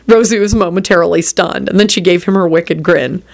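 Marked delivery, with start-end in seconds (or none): none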